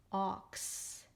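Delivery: OX stretched out, ending in a clear s; decoding demo. Shown as a demonstration. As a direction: slowly blend "ox" into its final s. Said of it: "read it as".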